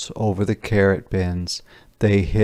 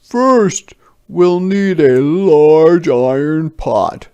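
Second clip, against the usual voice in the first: much higher and louder, grand; proclaiming soldier; 8 semitones higher, 8 dB louder, slower than usual. Acted. Soldier announcing stone soup to villagers, grand raised voice.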